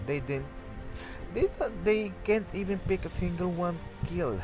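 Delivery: usual speaking voice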